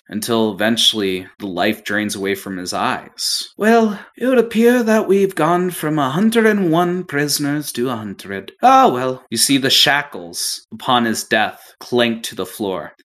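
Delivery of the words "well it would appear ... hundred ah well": higher, louder, offhand captain; breezy captain. Acted. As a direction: shrug off the death lightly. DM voices the captain.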